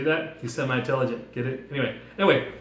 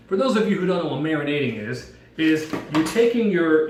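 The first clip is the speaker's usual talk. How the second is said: normal talking voice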